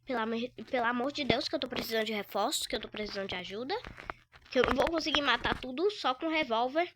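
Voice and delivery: urgent pleading. Real, not acted. Begging for help urgently.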